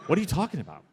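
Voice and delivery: high, incredulous question; baffled producer. Retold or imitated. Imitating the producer hearing it was [name]'s voice, incredulous. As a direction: play the producer thrown completely. Producer confused by his answer.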